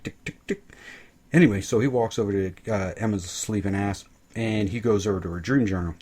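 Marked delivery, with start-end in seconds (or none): 0.0-0.6 whispered tick tick tick; breathy clock ticking under the breath; mock ticking whisper; ticking noise. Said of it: "Kieran's like".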